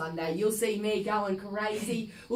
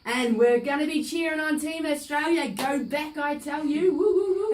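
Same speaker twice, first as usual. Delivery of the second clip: high, loud fan cheering; cheering superfan; 6 semitones higher, 4 dB louder than usual. Acted. Superfan cheering Team Australia on air, high whoops.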